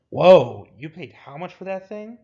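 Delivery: loud whoa, then climbing high in disbelief; astonished, pitch rising through the question; incredulous surprise. Acted. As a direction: balk at the price in disbelief. Reacts to the jacket's price.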